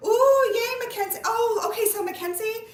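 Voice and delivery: high and loud, thrilled; excited squeal. Real, not acted. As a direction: squeal with delight at a friend's name. Happy at a viewer's comment.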